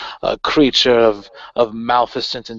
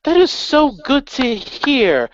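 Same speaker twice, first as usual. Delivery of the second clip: talking to a dog; 10 semitones higher than usual. Retold or imitated. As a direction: coo it like praising a pet. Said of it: "she'll say it the way, like, a person talks to, like, a dog".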